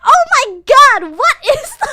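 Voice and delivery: high, loud shocked gasp of disgust. Retold or imitated. Sudachi shocked at the mess on the sheets. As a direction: recoil at the sight.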